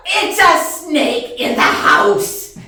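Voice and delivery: loud, slow, punched-out words; acted outraged holler; exasperated yell. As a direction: shout every word back at him. Exasperated reply to husband.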